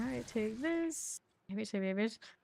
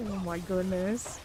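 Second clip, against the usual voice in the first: ordinary delivery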